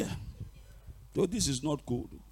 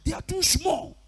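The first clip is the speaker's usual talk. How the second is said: scoffing; 14 semitones higher than usual. Real, not acted. Preacher belittling witches to congregation, high-pitched scoffing. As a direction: toss it off in a high voice.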